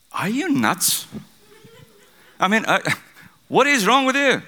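high, incredulous scolding as the captain; captain's shout. Retold or imitated. Captain confronting sleeping Jonah below deck, shouting. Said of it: "he shouted".

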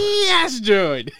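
very high, loud whooping cheer; excited shout. Real, not acted. Excited reaction to picked-up sound.

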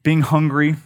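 ordinary delivery

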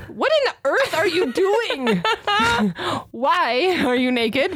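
dog's puzzled voice. Retold or imitated. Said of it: "looking at me like".

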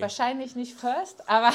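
loud laughing through the words. Real, not acted. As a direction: laugh while you answer. Laughing at cohost's joke.

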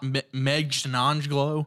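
slow, careful "Meghnanglo"; "Meghnanglo" drawn out syllable by syllable; careful pronunciation. Shown as a demonstration. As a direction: work through "Meghnanglo" slowly.

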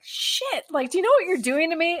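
high-pitched, overwhelmed reaction; mock shock. Co-host acting out a man hearing she likes him, shocked. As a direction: imitate someone thrown by a confession. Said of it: "taken so much by surprise".

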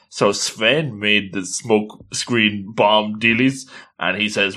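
ordinary delivery

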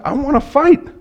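high, loud mock outburst; combative. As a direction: act itching for an argument. Acting out wanting a marital fight.

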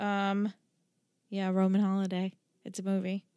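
usual speaking voice

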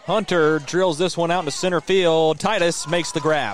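loud, rising play-by-play call; excited call. Real, not acted. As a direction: call the hit with a burst of energy. Big hit called live.

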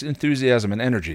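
ordinary delivery

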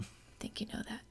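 fast, hushed whispered repeat; a conspiratorial whisper to the cohost. Real, not acted. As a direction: whisper it quickly as a tease.

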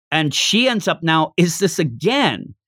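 ordinary talk, nothing marked

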